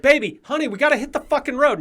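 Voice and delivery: hammed-up panicked shout; frantic yelling. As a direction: shriek the order to hit the road. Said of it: "He's like".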